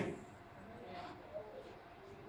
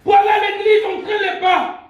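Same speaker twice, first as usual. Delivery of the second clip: loud, high-pitched sermon cry; full-force preaching; 10 semitones higher, 8 dB louder than usual. Real, not acted. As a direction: proclaim it to the whole church. (prédication enflammée, criée)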